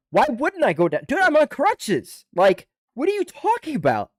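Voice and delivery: loud, high-pitched outraged retort; incredulous protest. Retold or imitated. Soldier on crutches answering being bitched at, high incredulous protest. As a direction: argue back in disbelief, voice shooting way up. Arguing back about going to hospital.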